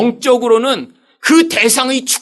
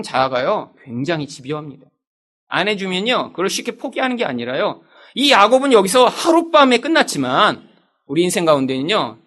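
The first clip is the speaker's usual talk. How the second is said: normal talking voice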